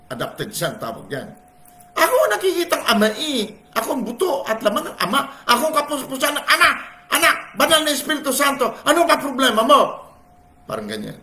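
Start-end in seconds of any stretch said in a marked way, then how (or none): 2.0-9.8 high, loud, fast, laughing boast; proud as a favored son; confident child of God. Pretend. Believer declaring he is the Father's own, high and laughing.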